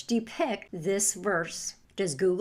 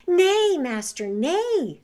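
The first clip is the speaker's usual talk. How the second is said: high, slow lamb's plea; pleading lamb; 8 semitones higher, 7 dB louder, slower than usual. A narrator's reading. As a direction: beg gently like a little lamb. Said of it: "said the lamb".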